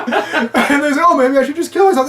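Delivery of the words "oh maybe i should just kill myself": high, loud mock self-pity; melodramatic.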